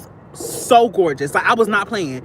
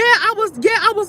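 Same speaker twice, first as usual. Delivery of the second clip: excited squeal; 13 semitones higher, 4 dB louder than usual. Real, not acted.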